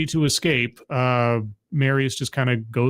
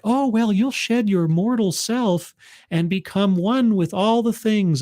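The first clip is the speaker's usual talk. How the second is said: airy, high-pitched, drawn-out promise; lofty druid explaining godhood; cult priest; 7 semitones higher than usual. Acted. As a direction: serenely sell the mystical transformation, high and slow. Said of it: "It's like".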